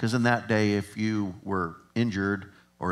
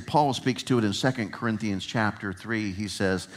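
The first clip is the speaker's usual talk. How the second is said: ordinary talk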